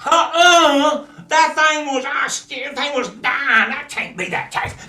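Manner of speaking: very high, loud disbelief; shocked cousin. Acted. Cousins stunned by the calf, very high and loud. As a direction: refuse to believe your eyes.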